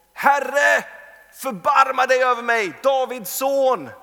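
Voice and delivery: a blind beggar shouting high and loud across the crowd, the last words slow; desperate cry for mercy. Acted. Blind man calling out to Jesus passing by, desperate loud cry.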